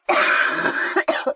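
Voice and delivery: harsh dry cough; coughing hard; real cough. Real, not acted. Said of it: "my coughing".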